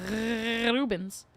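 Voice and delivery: "Rubens" with a long trilled R; rolled R. Shown as a demonstration. Streamer telling viewer about Rubens, long rolled R on "Rubens". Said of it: "with a rolled R".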